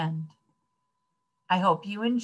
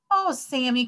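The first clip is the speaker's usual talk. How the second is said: higher-pitched exclamation of dismay; children crying out; 6 semitones higher than usual. A narrator's reading. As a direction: cry out in mild protest. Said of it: "cried the children".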